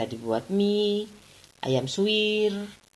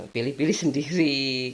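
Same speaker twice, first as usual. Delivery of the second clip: usual speaking voice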